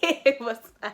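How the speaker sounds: giggling, embarrassed; laughing. Real, not acted. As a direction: giggle through it. Laughing after being teased.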